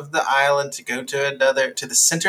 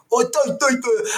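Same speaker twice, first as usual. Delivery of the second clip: put-on Kiwi accent; 9 semitones higher than usual. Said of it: "That's a pretty good Kiwi accent".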